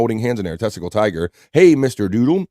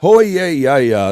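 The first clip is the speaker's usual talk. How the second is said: imitating the drop; 3 semitones higher, 4 dB louder, slower than usual. Host echoing a sound drop, hyped mock shout. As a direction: echo the clip's hype shout. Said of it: "sound bites".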